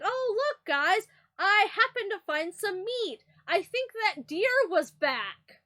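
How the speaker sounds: high, slow sweet fake-innocent voice; mock-innocent killer announcing meat, drawn out; imitating Maria. Imitating Maria cheerfully offering suspicious meat, high and slow. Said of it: "Maria's just gonna walk up and be like".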